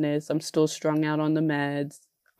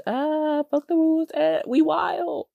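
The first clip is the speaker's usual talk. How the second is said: playful bravado; 10 semitones higher than usual. Pretend. Joking rule-breaking bravado.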